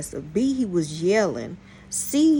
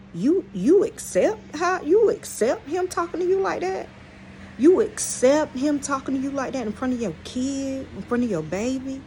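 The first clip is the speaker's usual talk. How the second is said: incredulous; 6 semitones higher than usual. Real, not acted. Talking to the girlfriend in the store, high incredulous challenge. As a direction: call her out, voice shooting up in disbelief.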